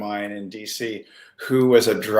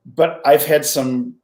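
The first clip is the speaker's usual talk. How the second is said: ordinary talk, nothing marked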